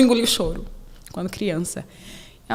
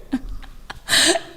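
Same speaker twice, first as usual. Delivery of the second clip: airy laugh; 7 semitones higher than usual. Real, not acted. Laughs at the memory.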